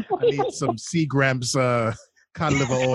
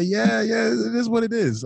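higher, lightly voiced shrug; higher resigned shrug; resigned reply; 7 semitones higher than usual. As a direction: brush it off with mock resignation. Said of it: "I say".

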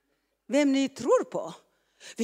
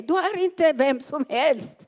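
ordinary talk

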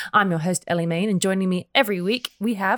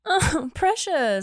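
higher slow coo; 8 semitones higher, much slower than usual. Real, not acted. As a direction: coo over something sweet. Coos at cat roof.

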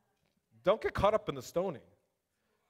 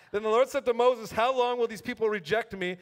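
raised, ringing Bible reading to the room; scripture reading; 4 semitones higher, 4 dB louder than usual. Acted. Preacher reading the spies' report aloud to the church, proclaiming. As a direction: proclaim the verse to the congregation.

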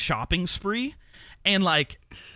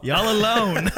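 laughing; 6 semitones higher, 4 dB louder, slower than usual. Real, not acted. Teasing the lonely viewers.

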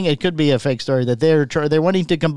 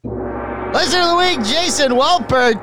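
high, slow, grand announcer call; 12 semitones higher, slower than usual. Pretend. Host crowning the listener of the week, grand announcer. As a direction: proclaim the winner like a game show host.

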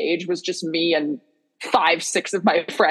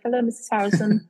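mock British accent, slightly raised; put-on accent; 4 semitones higher than usual. Fan joking about greeting Missus Harrison, put-on accent. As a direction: greet her in a playful imitation British accent. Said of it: "I would have said it in, in an accent".

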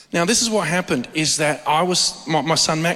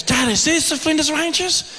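put-on higher, eager child's question; excited little son; 10 semitones higher than usual. Father voicing his eager son asking if they've arrived. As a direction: play the boy bursting to know.

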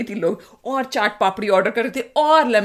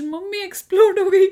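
weird laugh; 9 semitones higher, 3 dB louder than usual. Real, not acted. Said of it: "मुझे अजीब सी हंसी आ रही है".

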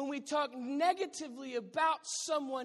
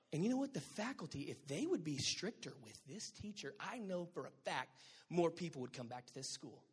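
much softer, lower catty agreement; second gossiping mom; 7 semitones lower, 7 dB quieter than usual. Retold or imitated. Another mom chimes in on teacher gossip, soft and catty. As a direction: agree smugly and pile on.

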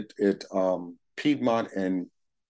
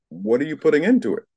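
put-on a sharper, higher old timer asking pointedly; pointed old timer question; 6 semitones higher, 4 dB louder than usual. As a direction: challenge him with the question, raised a little.

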